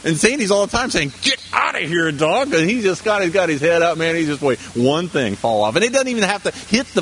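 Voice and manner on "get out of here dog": higher-pitched mock scolding; mock shooing. Wife chasing the dog from underfoot, mock scolding.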